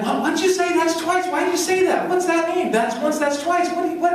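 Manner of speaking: high-pitched, baffled husband; high puzzled newlywed. Retold or imitated. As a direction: squeak out the confused questions. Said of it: "I'm like".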